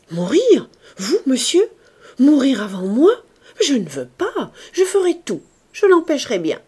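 high, loud outraged protest; protesting cry. Acted. Maid crying out against her master's death, high and loud. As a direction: refuse his death with all your being. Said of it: "dans un cri de protestation".

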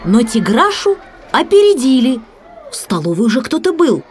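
plain reading voice

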